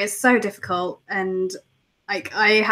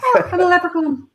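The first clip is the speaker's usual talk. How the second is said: hammed-up stage Irish, higher and laughing; Irish impression; 6 semitones higher, 4 dB louder, faster than usual. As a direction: rattle off Irish clichés like a leprechaun. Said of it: "reeling off".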